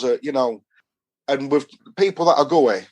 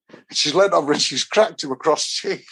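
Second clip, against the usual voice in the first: ordinary delivery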